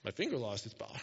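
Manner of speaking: low, soft deadpan with an exaggerated swoop; God, mock incredulous. God teasing Moses over his doubt, low mock disbelief. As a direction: play up the absurdity quietly. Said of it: "I love how God's like".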